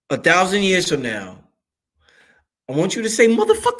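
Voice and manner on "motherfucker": very high, rising comic catchphrase; Brother Panic. Retold or imitated. Telling future listeners what to say, high comic catchphrase. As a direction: send the word soaring at the end. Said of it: "that's straight from my brother Panic".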